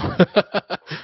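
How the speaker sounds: high-pitched chuckle; amused laugh. Real, not acted. Guest amused at a question about his dating, amused laugh. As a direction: burst into a quick, high laugh.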